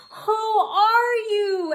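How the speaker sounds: very high, loud, slow question; astonished gasp. A narrator's reading. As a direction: cry it out high, stretching each word. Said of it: "everyone gra-, gasped".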